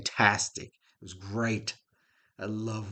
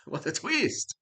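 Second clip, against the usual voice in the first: sarcastic; 8 semitones higher, slower than usual. Real, not acted. Sarcastic after obvious reveal.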